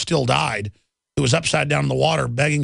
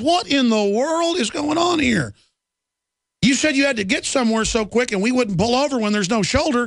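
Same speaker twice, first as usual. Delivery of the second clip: outraged paramedic; 6 semitones higher than usual. Retold or imitated. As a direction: imitate a stunned paramedic demanding answers.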